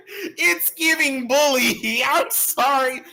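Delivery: very high, drawn-out playful squeal; high-pitched squeal. Pretend. Streamer apologizing to viewers for bullying, high laughing squeal. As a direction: squeal it out slowly through giggles.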